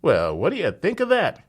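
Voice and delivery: high, loud bystander cry; cried out. Acted. Bystander cries out to the crowd, high loud cry. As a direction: call out in disbelief. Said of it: "cried a bystander".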